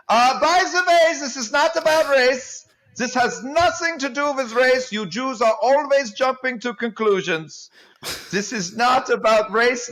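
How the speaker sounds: high-pitched, hammed-up German accent; mock a Nazi with a strident German accent. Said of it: "I'm doing the accent. I'm mocking Nazis".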